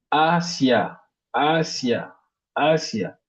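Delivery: Asia with a long, stressed opening "A"; teacher's demo of Asia, weight on "A"; stress on first syllable. Shown as a demonstration. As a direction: hold the first A of Asia, then drop. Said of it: "on met l'accent sur la première syllabe".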